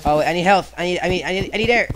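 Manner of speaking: frantic, high and loud; out of air. Real, not acted. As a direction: panic for breath. Running out of air.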